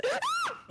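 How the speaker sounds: sharp high gasp of fright; startled high gasp. Real, not acted. Friend gasps at the scare, startled high gasp.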